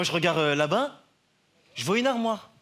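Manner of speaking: ordinary talk, nothing marked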